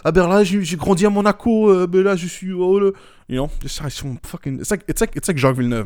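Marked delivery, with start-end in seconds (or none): 0.0-2.9 put-on Monegasque French accent. Mocking Nico Rosberg as a little Monegasque, in a higher mock French accent. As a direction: ham up a snooty Monaco-raised French speaker in a Monegasque accent. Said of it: "'cause he's a little monégasque".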